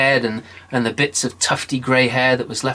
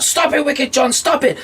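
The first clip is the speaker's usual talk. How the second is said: very high pleading cry; devil crying out; 13 semitones higher, 3 dB louder than usual. Acted. Devil begging John to stop, high pleading. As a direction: beg him high and desperate. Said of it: "the devil called out".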